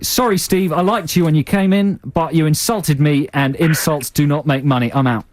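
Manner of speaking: high-pitched mock dragon's rejection; investor turning down a pitch. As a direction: reject them grandly, pitched well up. Playing an investor who rejects Steve.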